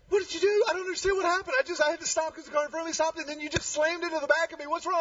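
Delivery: high, fast frustrated woman; animated upset woman. Retold or imitated. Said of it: "she was very animated".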